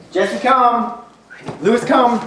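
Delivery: loud high call to each dog. Real, not acted. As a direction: shout each dog's name to bring them. Calling the dogs.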